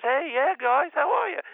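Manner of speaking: a bad, squeaky take on a famous singer. Rock star greets the table in a terrible high put-on voice. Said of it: "That's a terrible [name] impression".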